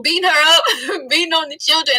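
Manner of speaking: real nervous laugh through the words; a defense-mechanism laugh. Real, not acted. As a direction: laugh uneasily while saying it. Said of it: "and I'm laughing".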